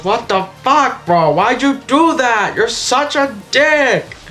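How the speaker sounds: high, slow mock outrage; exaggerated high-pitched complaint; Jax. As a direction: whine it in drawn-out indignation. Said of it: "And Jax goes".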